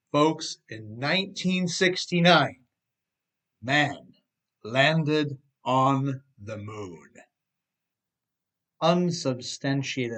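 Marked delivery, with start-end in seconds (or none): 0.1-7.1 grand announcement. Real, not acted. Grand pronouncement.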